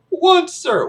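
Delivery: high, loud reply; straining cadet count. Acted. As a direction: sound off as the exercising cadet. Cadet counts sit-ups.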